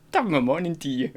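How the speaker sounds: quick Irish-accented greeting; stock Irish accent. Shown as a demonstration. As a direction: repeat a caricature Irish lilt. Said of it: "all I can do is".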